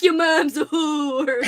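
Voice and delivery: slow, cheerful mock insult; lightly voiced, jolly Nelf, far from menacing; jolly not menacing. Shown as a demonstration. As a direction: make the threat sound merry, not scary. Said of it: "it's so jolly".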